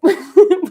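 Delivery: high, loud laugh. Real, not acted. Laughing at being horrible at makeup. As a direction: laugh at yourself.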